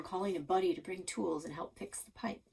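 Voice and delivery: usual speaking voice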